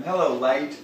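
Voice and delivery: usual speaking voice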